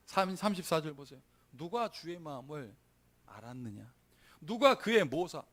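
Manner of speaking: ordinary talk, nothing marked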